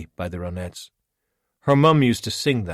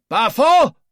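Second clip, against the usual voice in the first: much higher, much louder call; shout from afar; 13 dB louder than usual. Acted. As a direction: yell his name across the village. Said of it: "another shout from one of the huts".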